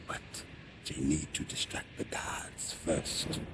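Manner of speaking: slow hushed whisper; whispered warning. Acted. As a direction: keep it secret from the guards. Secret plan in camp.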